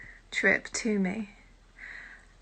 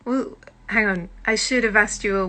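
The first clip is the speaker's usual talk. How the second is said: ordinary delivery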